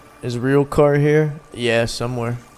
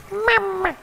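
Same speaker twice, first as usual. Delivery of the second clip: squeaky high mama. Pretend. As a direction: squeak it out like a baby voice. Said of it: "you gotta say it like that".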